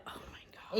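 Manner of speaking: breathless whispered aside. Real, not acted. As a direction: whisper it, worn out. Exhausted aside.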